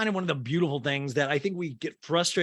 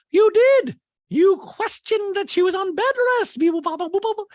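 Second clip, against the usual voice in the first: very high, loud mock accusation; Dorit impersonation; 9 dB louder than usual. Host mimics housewife challenging another's denial, high loud scolding. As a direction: accuse in a piercing caricature, then babble beeps. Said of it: "And Dorit goes".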